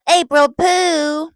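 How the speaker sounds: high, drawn-out sing-song call of a nickname; papa's greeting call. Retold or imitated. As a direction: call out the nickname slowly and high, like a playful grandpa. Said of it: "That's what he would call me".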